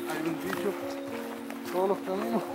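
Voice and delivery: hushed, soft voice; nervous night vlog whisper. Real, not acted. Joking quietly on the dark trail.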